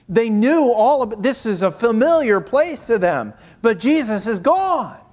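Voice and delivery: high, pressing pulpit voice; impassioned preaching. Real, not acted. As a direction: build to a loud, high-pitched proclamation. Preaches with rising force.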